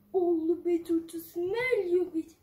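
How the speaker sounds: low, sly wolf voice. Acted. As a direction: purr it like the big bad wolf. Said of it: "said the wolf".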